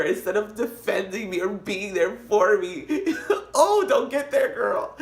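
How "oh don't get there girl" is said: high squealing laughter; laughing. Real, not acted. Telling her friend about her brother, high laughing. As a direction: crack up mid-sentence.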